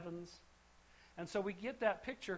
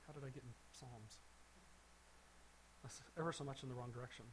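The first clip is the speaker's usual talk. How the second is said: muttered aside; 8 semitones lower, 15 dB quieter, much faster than usual. Real, not acted. Lost his page mid-lesson.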